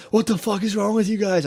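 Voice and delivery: freaking out. Retold or imitated. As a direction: imitate himself losing it. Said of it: "I started freaking out at them".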